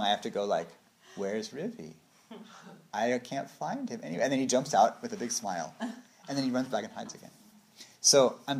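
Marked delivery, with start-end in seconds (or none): 1.2-4.2 playing along. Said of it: "I have to play along".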